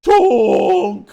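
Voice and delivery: loud, high goofy Sloth shout. Retold or imitated. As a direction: bellow it like the big Goonies guy. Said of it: "reminds me of Sloth from the Goonies".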